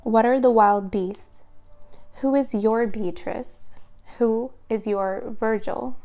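normal talking voice

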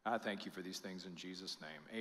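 soft, hushed, slowing prayer; pastoral prayer. Acted. Pastor closing the sermon, a soft hushed prayer. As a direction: pray quietly over the congregation.

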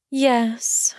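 a touch of sadness; student admitting she stays, mildly sad; a little sad. A narrator's reading. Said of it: "she replied a little sadly".

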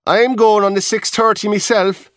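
a high chatty dancer calling down; high-pitched chatty Irish dancer; reel dancer. Acted.

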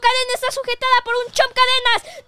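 high, loud shouts at a foe; battle shouting. Acted. Hero yelling at Bowser in the chain fight, high-pitched shouting. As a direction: shout it high and full force.

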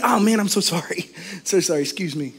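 soft, quick courteous sorry; hushed, deferential apology; polite apology. Acted. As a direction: apologize softly to a stranger you bumped.

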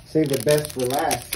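ordinary talk

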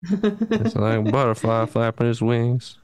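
loose cartoon impression, words running together; Boomhauer. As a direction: ramble on in a mumbled character voice. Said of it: "Boomhauer noises".

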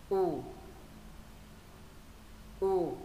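short U with lips flattened downward; brief, loose U; flat-lipped short U. Shown as a demonstration. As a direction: let the lips drop flat for U. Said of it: "Chữ U thứ nhất bẹt môi xuống này".

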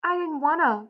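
high, distressed protest; crying out. A narrator's reading. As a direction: cry it high, falling at the end. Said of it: "Lenny cried".

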